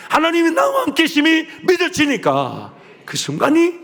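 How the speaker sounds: loud, high fervent preaching; impassioned pulpit preaching; preaching at full force. Real, not acted. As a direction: build the sermon to a fiery peak. Preaching climax, rising fervor.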